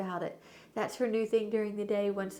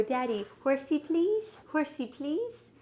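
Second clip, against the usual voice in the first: imitating toddler; 6 semitones higher, slower than usual. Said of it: "she'll come up and go".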